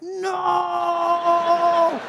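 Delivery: long high mock cry of horror; hammed-up shout of dismay; panicked yell. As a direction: stretch it into a loud wail. Retold wrong-way driving panic.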